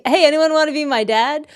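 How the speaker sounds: hammed-up hopeful shout for a dad; mock eager plea.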